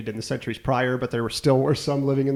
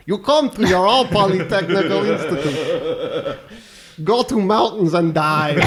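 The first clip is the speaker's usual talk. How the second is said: higher, louder mock Russian accent; thick Russian accent; 4 semitones higher, 3 dB louder than usual. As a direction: play a Soviet college ad in a Russian accent. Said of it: "they'd all be in thick Russian accents".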